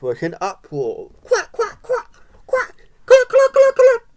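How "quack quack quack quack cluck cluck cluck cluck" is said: very high, loud quacks and clucks; squawking farmyard clucks. A narrator's reading. Farmyard animals in uproar, high squawking quacks and clucks. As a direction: squeal out the animal noises. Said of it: "go the hen".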